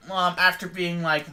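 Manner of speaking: usual speaking voice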